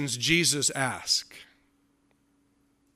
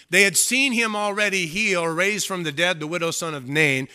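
usual speaking voice

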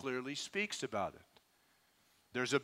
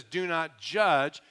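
each word slow and louder; slow emphatic command; 6 dB louder, much slower than usual. Acted. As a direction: lay it down like a verdict. Quoting Jesus with emphasis.

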